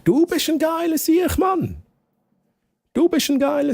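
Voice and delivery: high, shouted praise; hyped shout. Real, not acted. Streamer shouts high-pitched praise at a raider in the chat. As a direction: yell it out in excitement.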